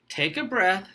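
calming breathing guide. Pretend. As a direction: talk them down like a meditation coach. Host calms contestant with breathing.

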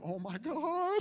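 loud, high "oh my God" with a laugh; hammed-up shock, breaking into laughter; startled outcry. Sick student startled by host mother, mock-shocked outcry. Startled as she rips shirt.

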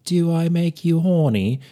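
high, loud spy-spoof impression; Austin Powers. Host trying out the film photographer's voice, Austin Powers impression. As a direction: belt it out as an over-the-top British swinger. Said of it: "it's really Austin Powers".

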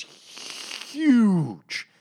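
"huge" stretched long, loud and high; exaggerated emphasis. Real, not acted. Complaining about her Hulk size, "huge" drawn out loudly. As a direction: blow up "huge" into one long high shout.

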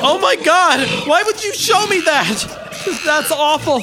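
high, loud horrified outburst; shocked shout. Acted. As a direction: recoil in shock and disgust. Shown gruesome accident video.

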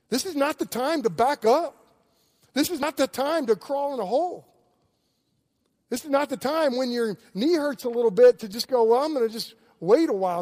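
rally the church to action; fiery call to walk with the Lord; preaching at full force. Acted. Preaching climax, rising exhortation.

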